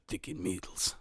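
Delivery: low, soft menacing game character; meaner voice line. Retold or imitated. Imitating the meaner new voice line, low and menacing. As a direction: drop it low and quiet, uninviting. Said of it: "it's so much meaner now".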